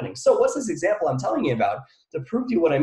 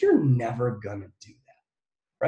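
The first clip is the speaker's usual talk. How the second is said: doubting inner voice; 5 semitones lower, slower than usual. Retold or imitated. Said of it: "that little voice back here that goes".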